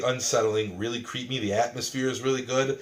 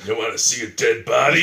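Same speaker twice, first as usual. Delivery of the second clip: louder lurking creep voice; creepy stranger voice; 5 dB louder than usual. Retold or imitated. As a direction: tempt them like a shady stranger. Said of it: "That's the guy who's gonna ask you".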